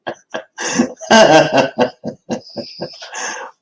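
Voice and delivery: high, breath-heavy laughing fit; long cackling laugh. Real, not acted. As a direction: laugh it out at your own joke. Laughs at his own joke.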